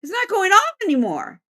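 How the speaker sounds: high-pitched baffled voice; puzzled spirit. Retold or imitated. Unseen prankster finds the sensor dead, high baffled voice. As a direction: play a prankster whose toy has failed. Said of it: "He goes".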